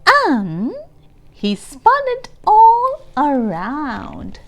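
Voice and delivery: put-on lively reading to young children; storybook read-aloud. A narrator's reading. Teacher reading Pete the Cat to the class, lively read-aloud. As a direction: read it like a picture book to kids.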